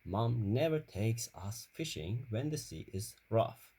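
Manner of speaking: ordinary delivery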